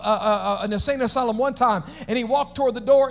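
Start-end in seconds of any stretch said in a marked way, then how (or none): none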